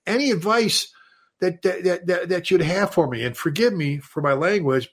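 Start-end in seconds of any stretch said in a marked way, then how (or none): 0.1-3.2 higher, deferential caller asking advice; a caller asking the chief for advice, pitched up and deferential; mock caller voice. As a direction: ask the chief politely in a pitched-up voice. Caller voiced in retold story.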